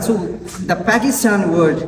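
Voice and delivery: normal talking voice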